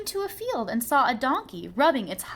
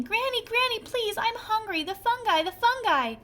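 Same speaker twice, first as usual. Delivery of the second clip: much higher, pleading hungry call; crying out; 11 semitones higher than usual. A narrator's reading. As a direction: cry for Granny urgently. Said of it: "He ran into his house crying".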